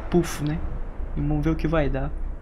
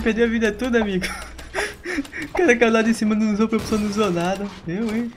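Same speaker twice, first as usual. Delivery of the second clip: ordinary talk, nothing marked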